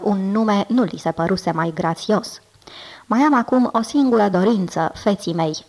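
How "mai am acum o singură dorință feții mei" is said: plain reading voice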